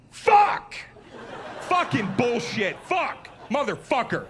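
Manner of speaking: high, loud swearing fit; furious swearing. Retold or imitated. Bar owner swearing at the comic as he storms off, loud and furious. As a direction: imitate the angry owner storming off cursing. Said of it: "he swore all the way down the hall".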